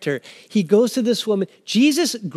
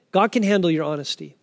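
normal talking voice

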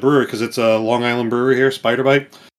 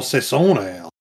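French accent. Real, not acted. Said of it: "you even get to the accent in there".

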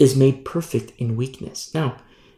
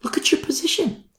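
whispered, emphatic aside; hushed urging to the congregation; 12 semitones higher, 5 dB quieter than usual. Real, not acted. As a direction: drop to a soft whisper.

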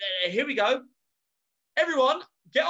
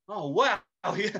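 normal talking voice

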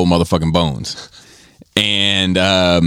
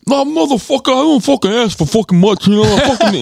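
much higher, mock-indignant put-on voice; Joel's voice; 3 dB louder than usual. Friend imitating Joel scolding him for forgetting. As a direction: squeal it like an offended old buddy. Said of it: "And he was like".

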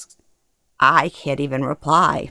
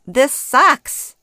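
higher, fed-up outburst; exasperated; 8 semitones higher, 4 dB louder than usual. A narrator's reading. Daughter stuck at the play, exasperated. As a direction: blurt it out in frustration.

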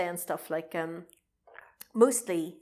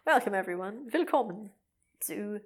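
game show host; 4 semitones higher than usual. Pretend. Mock TV show intro.

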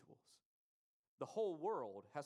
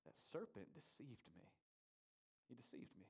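imitating Eve's answer, much softer; quiet confession; 2 semitones lower, 8 dB quieter than usual. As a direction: quietly voice Eve owning up. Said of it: "remember how she answers".

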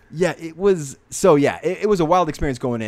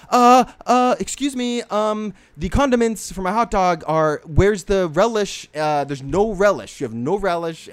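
entitled customer; 5 semitones higher, slower than usual. Retold or imitated. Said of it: "And she's like".

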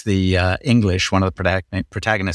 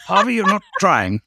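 1 mock teacher; 11 semitones higher than usual. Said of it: "every teacher I ever had in school".

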